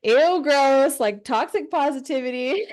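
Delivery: imitating critics who find it gross; mock disgusted critic. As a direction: sneer it like an online hater. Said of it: "people are gonna be like".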